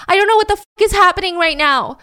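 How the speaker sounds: high, loud flabbergasted outburst; screamed in bafflement. Retold or imitated. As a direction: act out a flabbergasted suspect screaming. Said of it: "She screams".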